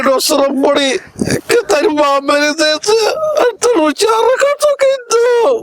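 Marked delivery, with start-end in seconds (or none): 1.5-5.5 put-on high, crying plea of an old father; weeping father. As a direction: break down over the dying mother. Father weeping over dying wife. (રડતા રડતા ઊંચા અવાજે વૃદ્ધ પિતા)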